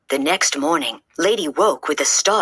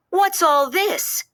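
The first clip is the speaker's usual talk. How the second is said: much higher, slow shocked outcry; shocked cry; 12 semitones higher, slower than usual. A narrator's reading. Aunt Sarah discovering the mess the cats made, high shocked cry. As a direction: gasp it out at the mess, high and drawn out. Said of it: "cried Aunt Sarah".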